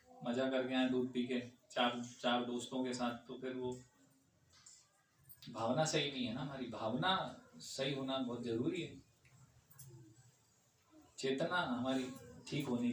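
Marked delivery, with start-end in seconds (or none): none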